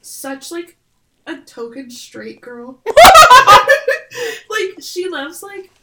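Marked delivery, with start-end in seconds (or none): 2.8-4.4 very loud high laughter. Real, not acted. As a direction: howl with laughter. Laughing about Kaylee.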